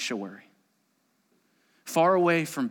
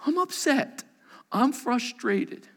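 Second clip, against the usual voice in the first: honest upset; 10 semitones higher, slower than usual. Acted. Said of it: "honest praying".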